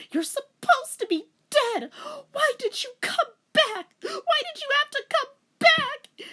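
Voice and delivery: high, loud, tearful plea; anguished crying. A narrator's reading. Daughter sobbing at her returned father, anguished. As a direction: cry it out in anguish.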